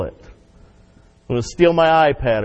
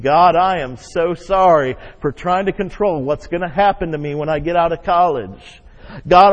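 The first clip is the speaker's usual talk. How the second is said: earnest, slightly louder prayer of repentance; fervent prayer; 3 semitones higher, 4 dB louder than usual. Acted. Pastor voicing his own prayer of repentance, fervent. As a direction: confess it like on your knees.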